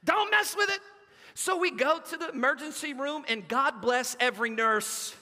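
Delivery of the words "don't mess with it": put-on much higher, louder frantic child's yell; panicked yelling. Boy with broken arm begging, frantic high yell. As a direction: yell it like a terrified hurt kid. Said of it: "he's freaking out".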